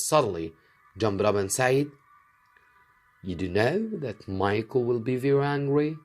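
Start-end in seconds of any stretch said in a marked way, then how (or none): 3.2-6.0 lightly raised, gentle princess voice; a hint of a princess addressing the king. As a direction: speak as the young princess, politely. Princess jumps up worried.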